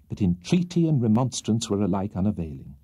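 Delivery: usual speaking voice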